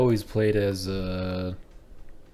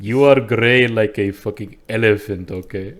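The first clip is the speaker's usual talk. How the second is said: louder, hammed-up Indian-accented retort; mock Indian accent; 9 dB louder than usual. Indian Nord arguing with the dark elf, mock Indian accent. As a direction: throw the insult in put-on Indian. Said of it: "I'm the Indian Nord".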